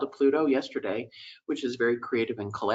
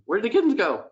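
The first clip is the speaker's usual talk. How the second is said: alarmed; 8 semitones higher, 5 dB louder, faster than usual. Retold or imitated.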